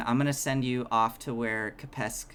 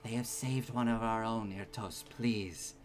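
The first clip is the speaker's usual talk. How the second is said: soft appeal; 7 dB quieter than usual. Acted. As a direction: quietly soothe an angry subject. Queen calms the growling Irtos.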